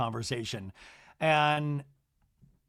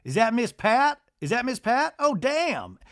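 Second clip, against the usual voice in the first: high, rising mock astonishment; 7 semitones higher than usual. J T spots Miss Pat across the tent, high mock surprise. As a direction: imitate him spotting someone, pitched up. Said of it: "He's like".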